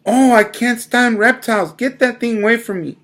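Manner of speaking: high, loud shriek. Acted. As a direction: shriek at the reptile. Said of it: "Miss Yarby shrieked".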